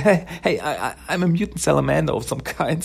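high, quick, awkward explanation with a nervous laugh; trying her best. Retold or imitated. Mona explains her mutation to her parents, trying hard. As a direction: act out an anxious daughter making light of it. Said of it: "she tries her best".

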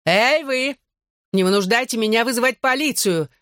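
high-pitched mild shout; calling out. A narrator's reading. Barmaid threatens brawlers with police, mildly shouted warning. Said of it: "крикнула барменша".

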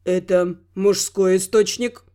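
low, slow, sullen question; gloomy question. Acted. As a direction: ask it darkly. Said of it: "хмуро вопросил Гал".

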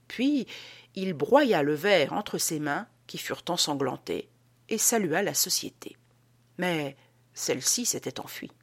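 plain reading voice

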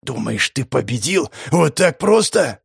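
slow spiteful hiss; menacing hiss. A narrator's reading. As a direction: hiss it slowly with hatred. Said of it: "прошипел".

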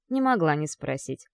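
plain reading voice

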